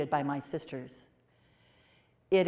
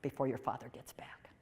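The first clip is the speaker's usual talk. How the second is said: hushed, breathless whisper "Go now"; urgent whisper; 5 semitones lower, 6 dB quieter than usual. Retold or imitated. Mother hurrying her daughter off before father returns, whispered. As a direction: whisper it so the father won't hear. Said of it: "And she said".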